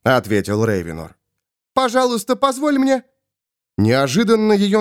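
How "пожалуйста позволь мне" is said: very high, begging voice; high-pitched woman's entreaty; pleading Svol. Acted. Svol begs again.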